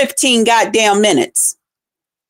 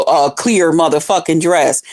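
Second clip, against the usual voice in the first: usual speaking voice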